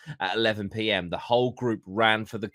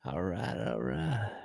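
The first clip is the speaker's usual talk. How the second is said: McConaughey impression; 6 dB quieter, slower than usual. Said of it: "Matthew McConaughey".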